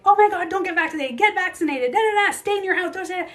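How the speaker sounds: high, loud mock panic; mocking panicky people. Mocking people's pandemic advice, high loud panic. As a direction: parody the frantic pandemic advice. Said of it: "people were getting like".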